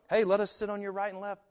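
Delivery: hammed-up disciples asking for the best seats; eager request. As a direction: play it like a pushy favor-seeker.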